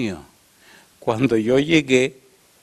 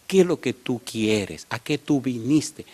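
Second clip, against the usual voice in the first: calmed down; 2 semitones lower, 4 dB quieter, slower than usual. Retold or imitated. Woman composes herself to question the stranger, calm and slow. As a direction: imitate her reining herself in, word by word. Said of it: "se calma, me dice".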